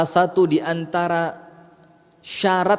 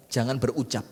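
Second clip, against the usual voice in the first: normal talking voice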